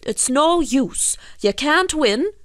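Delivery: high-pitched, drawn-out character voice; insistent fisherman. Acted. Fisherman tells the pulling fish to give up, insistent. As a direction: press the point as a fisherman.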